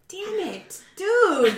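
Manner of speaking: high, playful exasperated groan; mock defeat. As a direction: throw it away in mock defeat.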